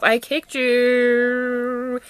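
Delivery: last word held very long; drawn-out boast. Acted. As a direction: stretch the final word right out. Mock triumph, word held very long.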